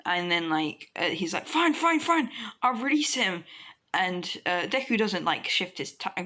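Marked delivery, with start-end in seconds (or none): 1.5-3.4 high, hurried giving-in; panicked surrender. Acted. Squeezed sludge villain agreeing to release hostage, panicked. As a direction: give in urgently, voice pitched up. Villain gives in under pain.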